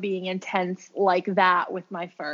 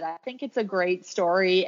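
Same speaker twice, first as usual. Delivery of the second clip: ordinary delivery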